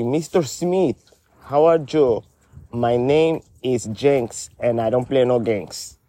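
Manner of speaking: ordinary talk, nothing marked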